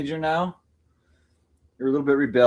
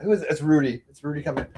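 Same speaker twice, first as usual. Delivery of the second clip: usual speaking voice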